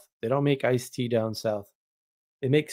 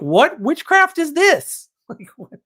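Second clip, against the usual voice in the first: hammed-up wonder at the sweet tea; amazed disbelief; 13 semitones higher, 5 dB louder than usual. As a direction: play up the shock at the tea's magic. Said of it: "And I'm like".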